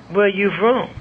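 high-pitched Texas lady's drawl. Retold or imitated. Texas lady questioning the student helping her, imitated Texas drawl. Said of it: "let me hear how she said".

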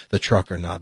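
ordinary talk, nothing marked

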